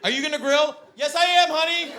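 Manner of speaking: high-pitched put-on domestic exchange; mock couple banter. Couple joking about grilling, playfully put-on.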